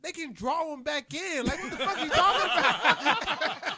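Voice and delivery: high, loud, slow voice breaking into laughter; laughing child disbelief. Retold or imitated. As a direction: plead like a confused kid while laughing. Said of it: "I was like".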